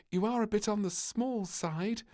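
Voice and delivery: mock-giggly general; tittering general. A narrator's reading. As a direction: tease the little one with a titter. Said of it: "tittered the general".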